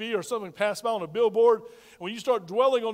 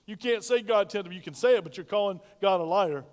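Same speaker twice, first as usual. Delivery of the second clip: normal talking voice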